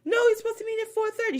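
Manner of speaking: high, quick put-on protest; Dorit's fake mixed accent. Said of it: "every time I do her impression".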